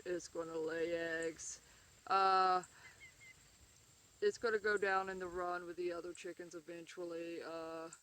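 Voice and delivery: slow, unsure voice of a husband who knows nothing of chickens; lightly mock the husband's bored chicken showcase; clueless husband impression. Wife lightly mocking husband's clueless chicken video, slow. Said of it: "I'm gonna do my impression of his".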